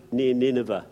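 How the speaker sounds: ordinary talk, nothing marked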